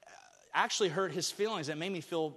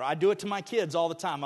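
ordinary talk

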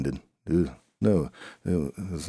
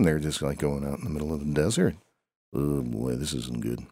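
ordinary talk

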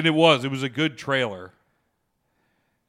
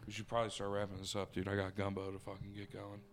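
chewing while talking; 2 semitones lower, 10 dB quieter than usual. Real, not acted. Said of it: "Sorry for the chewing this episode".

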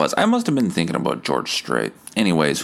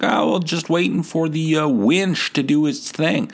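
Country Fest guy; 8 semitones higher than usual. Retold or imitated. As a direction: brag like a tipsy tow-truck driver. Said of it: "He's like".

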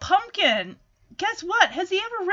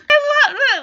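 ordinary talk

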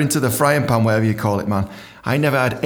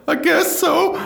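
meek squeak; much slower than usual. Retold or imitated. Meek reply to boss.

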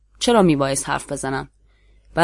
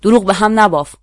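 sharp loud jeering call; lone shout from the crowd; 5 semitones higher, 7 dB louder than usual. A narrator's reading. A lone voice in the crowd shouts a loud accusation. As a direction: shout him down as a liar. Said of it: "صدای فریادی جداگانه برخاست".

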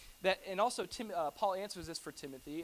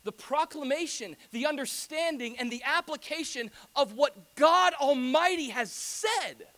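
loud, rising proclamation, slow at the end; a louder, higher pulpit build; preaching at full force; 7 semitones higher, 8 dB louder, slower than usual. Acted. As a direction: drive it home from the pulpit. Preaching climax.

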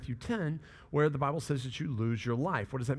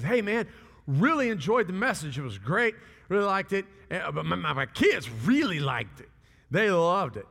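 higher, louder, gushing praise; enthusiastic compliment; 5 semitones higher, 6 dB louder than usual. Retold or imitated. Attender praising the pastor's message, enthusiastic. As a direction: act out an eager fan's compliment.

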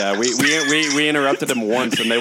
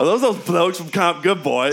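higher-pitched put-on British accent; playful fake British lilt, pitched up; mock British accent; 6 semitones higher, 3 dB louder than usual. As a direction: try out a hammed-up British accent. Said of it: "How's my accent now".

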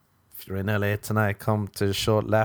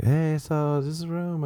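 high woman voice; 8 semitones higher than usual. Retold or imitated. Voicing the girl roommate.

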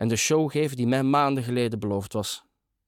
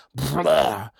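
loose-lipped, raised wordless outburst; burbling cry of release; drawn-out "blah"; 4 semitones higher, 3 dB louder than usual. A narrator's reading. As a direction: blurt out a long theatrical bleat. Acts out his cosmic connection.

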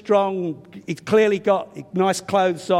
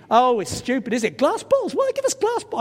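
much higher, incredulous blurt; thoughtless blurt; 5 semitones higher than usual. Retold or imitated. Preacher mocking his own thoughtless airport remark, much higher. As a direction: squeak it out like an indignant tourist. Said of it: "like I did without thinking".

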